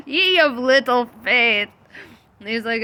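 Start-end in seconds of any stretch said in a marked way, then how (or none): 0.1-1.7 amused rebuke. Retold or imitated. Jesus teasing the disciples.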